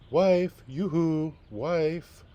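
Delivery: high, loud sing-song call across the field. Acted. Farmer calling his wife for help, loud sing-song call. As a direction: call her in from far away.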